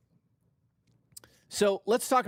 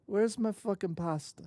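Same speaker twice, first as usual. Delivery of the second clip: higher-pitched silly Italian voice; mock Italian accent; 4 semitones higher, 3 dB quieter than usual. Streamer repeats his pasta demand, mock Italian accent. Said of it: "The accents are killing me".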